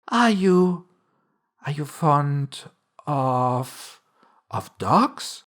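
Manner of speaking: halting "Are you, are you fond of, of dogs"; hesitant question. A narrator's reading. Alice gropes for another subject, hesitant "of, of dogs". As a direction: start high on "Are you", then stretch "fond of" and pause before "of dogs".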